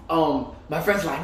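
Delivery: usual speaking voice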